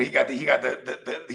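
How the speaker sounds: usual speaking voice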